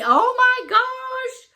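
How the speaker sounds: high, drawn-out delighted gasp; surprised gasp. Real, not acted. Fan opening a card, surprised and delighted. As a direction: caught off guard by a present.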